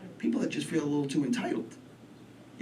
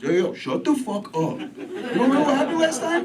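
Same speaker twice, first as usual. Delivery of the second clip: a louder, higher street-tough voice; brain voice warning; 12 semitones higher, 6 dB louder than usual. Acted. Brain memory warns the speaker, louder tough-guy voice. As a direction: scold yourself like a tough guy from Brooklyn. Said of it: "And it goes".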